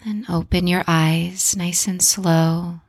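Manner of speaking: calm guided breathing voice. Acted. Leads a breathing exercise.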